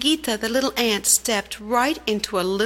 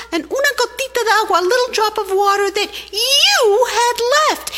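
much higher, loud, fast ant demanding justice; indignant little ant; 12 semitones higher, 4 dB louder than usual. Acted. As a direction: scold the rain like a tiny furious ant. Ant confronts the rain.

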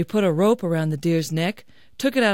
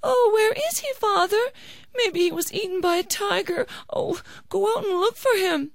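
high, plaintive falsetto; worried wife; 14 semitones higher than usual. A narrator's reading. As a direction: fret and plead in a high wifely voice. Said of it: "Kabayan's wife became worried".

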